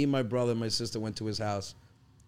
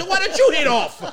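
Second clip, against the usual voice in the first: much higher and louder dad, laughter breaking in; 11 dB louder than usual. Retold or imitated. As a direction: fire the question back at the son. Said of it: "He goes".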